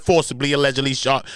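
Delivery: usual speaking voice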